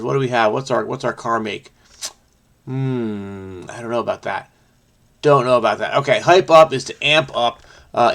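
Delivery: ordinary delivery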